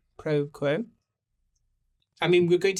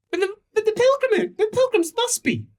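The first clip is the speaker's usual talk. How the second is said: much higher, protesting voice; high-voiced character; 3 dB louder than usual. Townsperson pressing about the missing pilgrims. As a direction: pipe up in a thin, high character voice.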